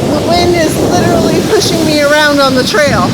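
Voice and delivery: loud, high yelling into the gale; shouting over wind. Real, not acted. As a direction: shout every word over the storm. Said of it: "I don't know if you can hear me".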